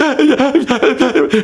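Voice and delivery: high, choked laugh through tears; teary laugh. Retold or imitated. Pastor laugh-crying as the cop walks over. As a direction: laugh helplessly mid-sob.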